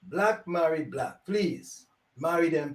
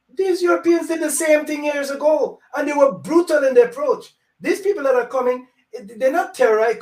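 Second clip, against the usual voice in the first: heated accusation; 7 semitones higher, 4 dB louder than usual. Real, not acted. Heated accusation, louder and higher.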